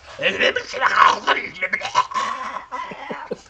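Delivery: high mad cackle; crone cackle. Acted. Old crone cackling as she hands over her tongue, mad cackle. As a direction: cackle like a dying witch. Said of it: "and says".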